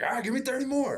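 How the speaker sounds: high, fast coach's demand; coach barking orders. Retold or imitated. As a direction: rattle off a high-pitched order. Coach demanding more exercise, retold.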